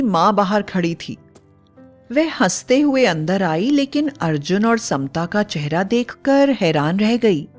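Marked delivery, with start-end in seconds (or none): none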